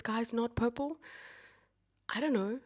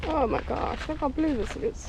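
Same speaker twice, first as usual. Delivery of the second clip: high, thrilled exclamation; excited; 8 semitones higher than usual. Real, not acted. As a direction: gush over the blue water. Arriving at bright blue lake.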